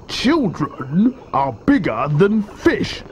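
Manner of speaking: slow, high croc argument; drawn-out crocodile retort; arguing crocodile. A narrator's reading. Enormous crocodile argues.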